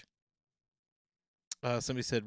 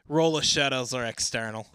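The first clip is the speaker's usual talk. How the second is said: louder Aussie reading voice; loud Australian accent; 10 dB louder than usual. Acted. Reading a forum answer in an Australian accent. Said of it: "in my Down Under accent".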